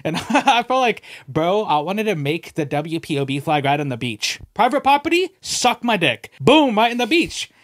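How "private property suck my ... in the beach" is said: mock triumphant. Mock claiming the beach.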